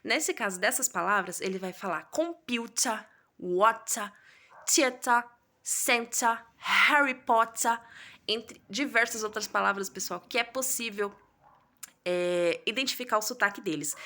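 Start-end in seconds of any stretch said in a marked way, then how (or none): 2.1-7.8 British "water" said "wo-tah", louder; British "Harry Potter", slow and drawn out, loud; British accent. Shown as a demonstration. Teacher to class, exaggerated British-accent "Potter". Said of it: "Vamos ver como que o britânico fala".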